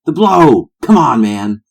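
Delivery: loud, high outburst; exasperated tennis star. Acted. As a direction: holler it like an impatient party animal. Plays McEnroe in script.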